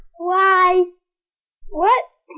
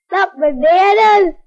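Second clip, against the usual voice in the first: insistent not bananas, louder; loud correction; 7 dB louder than usual. Real, not acted. Child correcting a friend's guess, loudly. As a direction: stamp out the wrong word.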